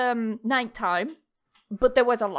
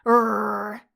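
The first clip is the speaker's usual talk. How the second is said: imitated dog growl; 3 semitones lower, 5 dB louder than usual. Shown as a demonstration. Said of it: "it's the noise that a dog makes".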